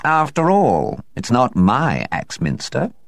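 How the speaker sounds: slow, dry cat voice; a hint of a haughty cat; dry rejoinder. A narrator's reading. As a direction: drop it with cool disdain. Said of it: "Tobermory's rejoinder".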